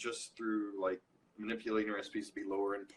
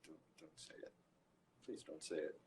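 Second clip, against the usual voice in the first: much softer, almost under the breath; dreading plea; 7 semitones lower, 13 dB quieter than usual. Retold or imitated. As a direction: beg quietly in dread. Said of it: "And I'm just like".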